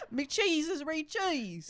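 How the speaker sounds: slow, high British lament; mock British accent. Repeating the sandwich guy joke, slow high British accent. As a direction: draw it out in exaggerated British. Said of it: "making fun of accents".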